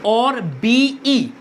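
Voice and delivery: very slow, letter by letter; slow spelling. Shown as a demonstration. Teacher spelling the short form बीई aloud for students. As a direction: spell out बीई slowly for the class. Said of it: "बी भी हो सकता है और बीई भी".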